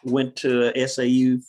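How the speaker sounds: ordinary talk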